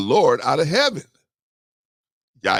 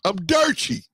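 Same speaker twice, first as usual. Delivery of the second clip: high, shouted getaway cry; hammed-up getaway shout; excited Lot; 9 semitones higher than usual. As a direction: Lot bolting off, whooping. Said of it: "he just said".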